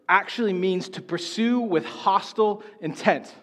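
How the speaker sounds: ordinary talk, nothing marked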